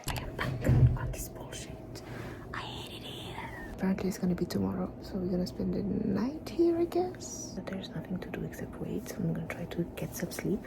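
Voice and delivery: soft breathy hospital whisper; real whisper. Real, not acted. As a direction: whisper so as not to wake anyone.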